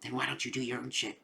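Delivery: quiet mock put-down; hushed retort. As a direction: throw it away under your breath. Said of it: "It's like".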